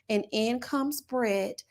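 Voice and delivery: ordinary delivery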